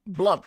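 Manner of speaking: squeaky loud blub; loud high bubble noise. Acted. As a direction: burst out a bubble sound. Bubbling underwater.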